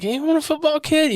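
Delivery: very high, soft imitated Santa; department-store Santa. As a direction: lightly toss out the offer in a put-on high voice. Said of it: "And Santa's like".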